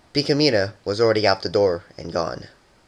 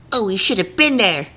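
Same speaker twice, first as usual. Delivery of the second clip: high, louder chatter; excited; 10 semitones higher, 5 dB louder than usual. A narrator's reading. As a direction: gush to the mirror. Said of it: "said aloud to her reflection".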